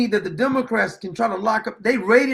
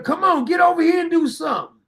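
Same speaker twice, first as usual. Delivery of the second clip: raised, calling-out dare; pitched-up challenge, come on then; taunting challenge; 6 semitones higher than usual. Real, not acted. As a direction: egg them on to act. Taunting officials to act.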